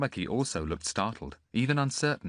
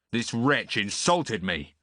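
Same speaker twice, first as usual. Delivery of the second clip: slow, seething complaint; stormed; 3 semitones higher, 4 dB louder, slower than usual. A narrator's reading. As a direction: spit it out slowly in outrage. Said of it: "stormed Dios".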